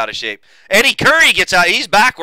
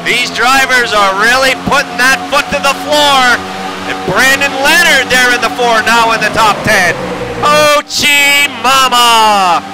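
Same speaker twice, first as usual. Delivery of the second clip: loud-and-clear, higher-pitched race call; live race play-by-play; 6 semitones higher, 4 dB louder than usual. Real, not acted.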